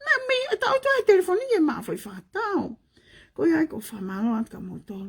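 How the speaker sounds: ordinary talk, nothing marked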